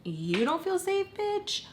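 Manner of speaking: high, drawn-out incredulous question; scornful high-pitched challenge; mock disbelief. Her scornful thought at him.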